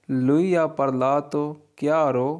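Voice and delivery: very slow, each Italian word laid out clearly; slow model reading. Shown as a demonstration. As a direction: read it slowly for learners to copy. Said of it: "teacher models Italian sentence". (धीरे-धीरे साफ़ उच्चारण)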